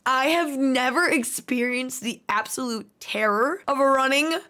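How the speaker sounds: ordinary delivery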